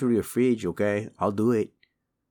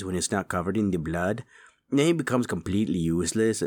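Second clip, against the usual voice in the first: usual speaking voice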